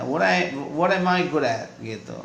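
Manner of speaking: normal talking voice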